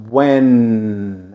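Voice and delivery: "when" with the tongue up at the roof of the mouth; teacher modelling "when"; careful English when. Shown as a demonstration. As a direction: say "when" slowly and clearly, tongue to the palate. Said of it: "A língua lá no céu da boca".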